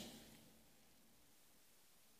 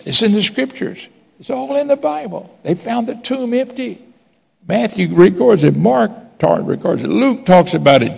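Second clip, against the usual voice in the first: preaching at full force; 11 semitones higher than usual. Acted. Pastor preaching the empty tomb to the congregation, full force. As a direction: lift it up and proclaim it to the church.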